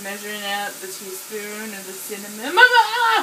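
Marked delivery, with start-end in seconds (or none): 2.5-3.2 sudden high startled cry; startled yelp. Real, not acted. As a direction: yelp in surprise. Cinnamon pours out suddenly.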